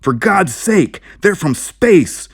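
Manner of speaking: high, loud, slow desperate scream. Acted. Narrator screaming at the sheriff about the spacemen. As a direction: scream it at him in panic. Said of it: "I screamed".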